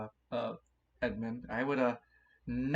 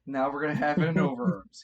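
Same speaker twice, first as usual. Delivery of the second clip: excited; 3 semitones higher, 8 dB louder than usual. Real, not acted. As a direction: raise the voice with glee. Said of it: "excited loud reaction".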